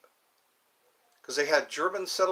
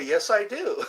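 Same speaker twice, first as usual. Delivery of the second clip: lightly put-on Australian accent, higher; imitated Australian reply; 6 semitones higher than usual. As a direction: tease the tourist with an Aussie yes. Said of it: "And they say".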